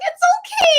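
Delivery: very high, loud squeal; excited reassurance. Retold or imitated. Reassuring nervous idol, excited.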